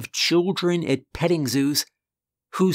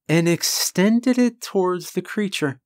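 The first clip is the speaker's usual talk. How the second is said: ordinary delivery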